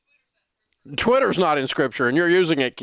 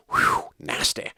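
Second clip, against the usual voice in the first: disgusted; slower than usual. Real, not acted. Reacting to cuss words.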